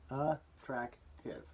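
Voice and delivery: attractive said slowly, each syllable apart. Shown as a demonstration. Teacher to spelling class: attractive sounded out slowly. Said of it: "in terms of attractive".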